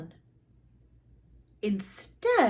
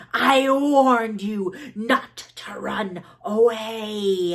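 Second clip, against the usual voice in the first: slow, louder serpent threat; a snake's slow threatening hiss; 5 dB louder, slower than usual. Acted. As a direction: menace her, drawing it out. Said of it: "a Mankamek hissed".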